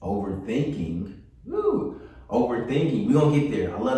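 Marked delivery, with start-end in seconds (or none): 1.4-2.0 quick, high-pitched whoop; high "woo". Real, not acted. Teacher reacting to the topic of overthinking, high whoop.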